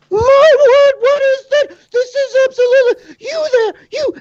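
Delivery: put-on very high, loud, flustered old woman's cry; alarmed barkeep. Betty spotting the wolf in her bar, alarmed and very high. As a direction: point and demand help in a shrill panic. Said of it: "Betty sees this massive canine".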